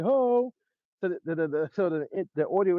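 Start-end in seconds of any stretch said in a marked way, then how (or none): 0.0-0.5 a sharp high ho ad-lib; shouted ho. Retold or imitated. Artist in the booth ad-libbing a high ho. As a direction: bark out a high ho. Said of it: "Legends in there like".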